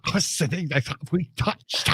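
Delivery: high, hushed mock-starstruck squeal; giddy fanboy. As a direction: play a swooning fan, small and high. Mock starstruck fan after meeting the star.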